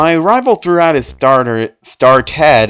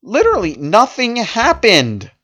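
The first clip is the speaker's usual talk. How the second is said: higher, slow deadpan complaint; exasperated disbelief; 7 semitones higher than usual. Real, not acted. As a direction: spell out the letdown slowly. Mocking the story's flat ending.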